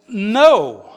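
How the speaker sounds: a firm, drawn-out "No" that falls away; emphatic no. Retold or imitated. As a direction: put full, emphatic weight on "No". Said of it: "he says it in the emphatic".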